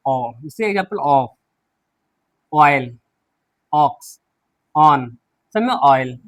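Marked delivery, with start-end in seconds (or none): 0.1-1.3 ऑफ opening on a low "aw" vowel; short open o. Shown as a demonstration. Teacher demonstrates initial o, ऑफ said with "aw". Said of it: "तो हम पढ़ते हैं ओ". 2.5-5.2 ऑयल, ऑक्स, ऑन low and spaced, open "aw"; model pronunciation. Shown as a demonstration. Teacher models examples, ऑयल, ऑक्स, ऑन with "aw". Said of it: "इस तरीके से हम इसको pronounce करते हैं".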